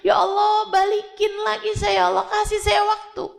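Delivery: high, begging cry of a dying man; desperate plea to God. Acted. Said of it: "dia akan bilang".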